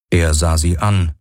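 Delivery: ordinary delivery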